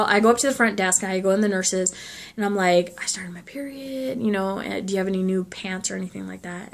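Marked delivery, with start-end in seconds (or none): 3.0-6.7 whisper. Retold or imitated. Embarrassed request at nurse's desk.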